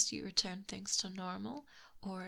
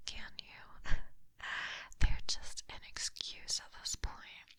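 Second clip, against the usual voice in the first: intimate whisper right at the ear; close breathy whisper. Acted. Snake captor taunting the prince up close, breathy whisper. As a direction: lean in and breathe the words.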